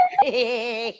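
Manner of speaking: light cheerful laugh; demonstrating a giggle; demo laugh. Shown as a demonstration. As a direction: show the kids a happy laugh. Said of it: "just like you smiling and laughing".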